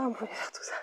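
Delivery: very soft whispered remark; whispered aside. Real, not acted. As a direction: drop to a whisper, confidingly. Confiding about her little notebook. (aparté chuchoté)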